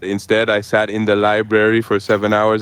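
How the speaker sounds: ordinary talk, nothing marked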